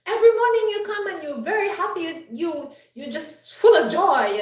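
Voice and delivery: high, loud, angry outburst at the cheerful coworker; went off. Retold or imitated. Grumpy coworker yells at the always-happy colleague, angry shout. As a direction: snap at her like a man in a foul mood. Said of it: "And he just went off".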